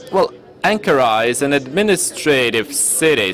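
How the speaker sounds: normal talking voice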